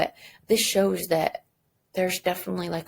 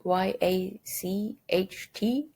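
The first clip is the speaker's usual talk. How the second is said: spelled out; much slower than usual. Shown as a demonstration. Teacher spells the word for students, slowly letter by letter. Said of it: "how to spell it".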